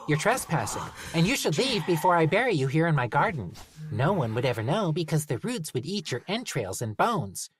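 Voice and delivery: high, cheerfully menacing gnome. Acted. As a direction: sweetly threaten to bury him. Gnome threatens trespasser.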